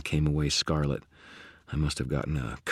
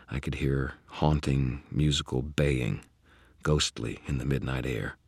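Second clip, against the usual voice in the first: ordinary delivery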